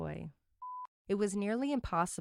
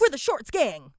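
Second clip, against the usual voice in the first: mock gang voice; 5 semitones higher, 7 dB louder than usual. Voicing the shorts gang.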